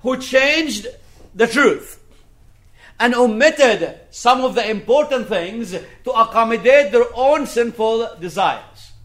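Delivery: high, emphatic sermon delivery; forceful preaching. Real, not acted. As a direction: hammer each phrase home from the pulpit. Preaches on changing the truth.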